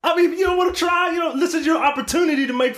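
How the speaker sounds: excited urging. Real, not acted. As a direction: egg your friend on, voice way up. Teasing friend into trying.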